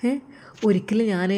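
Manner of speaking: usual speaking voice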